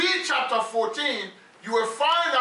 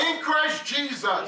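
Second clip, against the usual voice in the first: fervent preaching; 4 semitones higher, slower than usual. Acted. Preacher calling out lines for the congregation to echo, fervent. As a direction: lift the church with each phrase.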